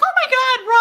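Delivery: high shrieking mock panic. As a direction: squeal like a scared coward. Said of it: "I'd be like".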